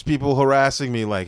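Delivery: ordinary talk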